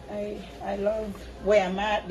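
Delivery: ordinary talk, nothing marked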